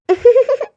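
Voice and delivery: short, high-pitched laugh; light burst of giggling; high giggle. Acted. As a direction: giggle briefly, high and quick. Girl laughs after being cheered.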